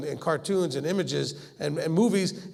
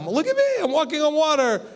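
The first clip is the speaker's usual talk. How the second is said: higher, brighter thrill at a miracle; excited; 8 semitones higher than usual. Retold or imitated. Believer thrilled at walking on water, excited. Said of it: "instead of really getting excited about".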